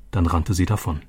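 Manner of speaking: plain reading voice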